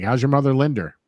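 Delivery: "Linder" closing on an R; Linda with added R. Retold or imitated. People asking after his mother, "Linder" with added R. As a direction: mimic them, putting an R on "Linder". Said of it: "people out there like".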